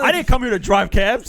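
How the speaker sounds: high, loud, drawn-out protest; hammed-up offended yell; mock outrage. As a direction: shout it in indignant disbelief. Mock indignant reply to cab mistake.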